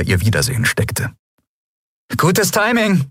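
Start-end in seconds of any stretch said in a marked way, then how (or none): 2.2-3.1 a much higher, bright call of welcome. A narrator's reading. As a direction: call it out gladly. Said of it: "rief sie".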